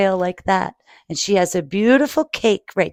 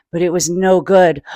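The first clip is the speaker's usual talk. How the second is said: plain reading voice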